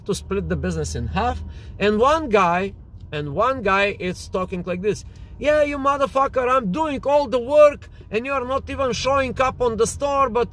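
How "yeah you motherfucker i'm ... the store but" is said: a hothead unloading on his partner; high energy rant. Retold or imitated. Partner yells at partner at negotiating table, angry high pitch. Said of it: "high intensity, high energy game".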